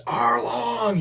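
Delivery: loud, high, stretched-out yell; hammed-up cry of agony; mock anguished scream. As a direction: wail the name out long, imitating her despair. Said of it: "her arm is just covered in blood".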